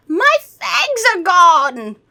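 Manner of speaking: high, loud, slow vampire wail; vampire finds his fangs gone; shocked vampire. Acted. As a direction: wail the loss. Vampire discovers lost fangs.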